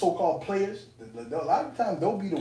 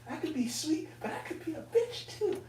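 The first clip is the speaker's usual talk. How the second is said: much higher mock woman's voice with a laugh; mocking her, laughing; 12 semitones higher, 5 dB quieter than usual. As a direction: laugh through a high parody of her. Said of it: "she's like".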